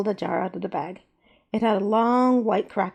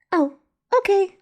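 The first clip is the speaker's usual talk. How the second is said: put-on high, slow small child; a young girl agreeing sweetly; little girl voice; 12 semitones higher, 5 dB louder than usual. A narrator's reading. Little girl Zena answers.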